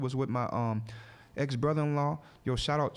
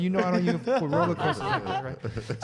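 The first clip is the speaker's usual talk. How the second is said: laughing; 3 semitones higher, 3 dB louder than usual. Retold or imitated. Retold retort, laughing through it.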